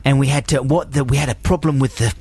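hesitant, rambling fake Aussie; badly faked Australian storyteller; mock Australian accent. Australian friend recounts fairy lights trouble, mock Australian accent. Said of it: "they're very poor Australian accents".

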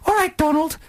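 put-on a child cheerfully answering his parents by their first names; child calling parents. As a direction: chirp the names up high like a little kid. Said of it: "they'd say".

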